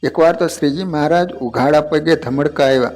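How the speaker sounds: ordinary talk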